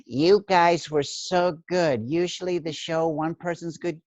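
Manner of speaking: lightly voiced gushing fan. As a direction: coo over the performers like a gushing fan. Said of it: "people would come out and go".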